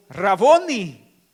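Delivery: a long, loud, drawn-out cry; joyful cry of recognition. Acted. Mary suddenly recognizing her risen teacher, a long loud cry. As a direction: pour out the joy of recognizing him.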